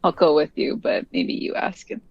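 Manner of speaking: usual speaking voice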